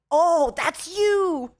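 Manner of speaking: loud, high, drawn-out mock recognition; excited realization. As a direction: exclaim it like the penny just dropped. Retold fans finally recognize her.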